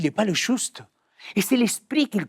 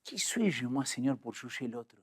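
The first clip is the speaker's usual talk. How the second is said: soft, low, fading to very quiet; humbled inner prayer; 8 semitones lower, 10 dB quieter than usual. Retold or imitated. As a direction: quietly question yourself before God, trailing down. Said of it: "j'ai senti à l'intérieur de moi". (prière intérieure, humble et tout bas)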